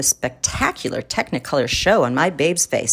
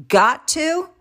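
much higher, sharp rising disbelief; incredulous echo; 11 semitones higher than usual. Real, not acted. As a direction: toss it back, aghast. Echoing wife's refusal, aghast.

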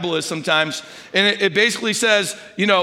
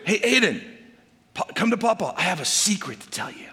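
soft, inviting, dropping quieter at the end; gentle coaxing; 4 dB quieter than usual. Shown as a demonstration. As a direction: lure him in with a quiet secret. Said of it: "From that to".

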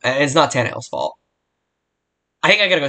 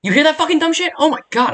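high, louder burst of real irritation; exasperated outburst; 4 dB louder than usual. Real, not acted. Furious at neighbor's barking dog, asks listeners in a high outburst. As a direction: fling it out, fed up with the noise.

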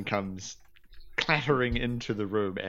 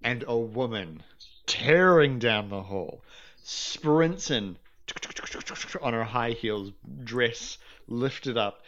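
ordinary talk, nothing marked

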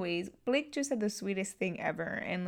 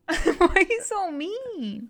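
slow laughing whine, higher and louder; laughing protest; 10 semitones higher, 7 dB louder, much slower than usual. Real, not acted. Laughing at friend's dumpster joke, slow high protest. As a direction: laugh out the complaint.

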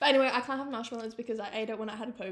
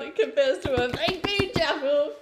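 excited; 4 semitones higher, 8 dB louder, slower than usual. Real, not acted. Gushing about baked bean jaffles.

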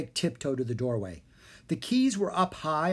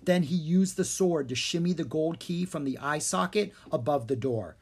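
ordinary delivery, nothing marked

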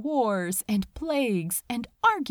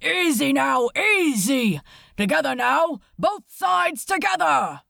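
loud, higher shouted commands; calling out orders; 4 semitones higher, 7 dB louder than usual. Acted. A sailor shouting to the men lowering the lifeboat, loud. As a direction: bark it across the deck to the crew.

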